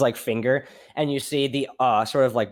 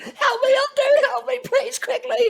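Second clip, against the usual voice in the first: shrill pleading, crying out, louder; terrified high plea; 5 dB louder than usual. Acted. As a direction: scream for help in terror. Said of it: "he's like".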